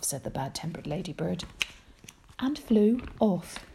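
usual speaking voice

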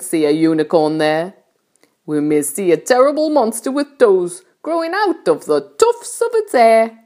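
lively rhythmic comic recital; centipede's verse. Acted. Centipede recites wonders to friends, lively declaimed verse. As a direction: declaim the rhyme with relish, swooping on key words.

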